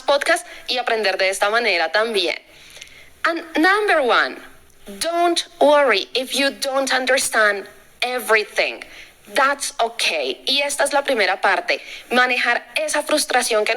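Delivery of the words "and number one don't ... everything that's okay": cada palabra en inglés dicha despacio y clara; inglés lento y claro. Acted. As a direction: lee el consejo en inglés como para alumnos. Consejo leído en inglés. (inglés pausado de profesora)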